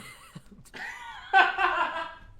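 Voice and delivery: very high cackling laugh. Real, not acted.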